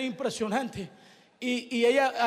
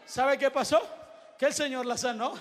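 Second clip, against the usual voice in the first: ordinary delivery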